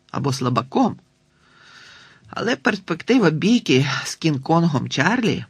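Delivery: plain reading voice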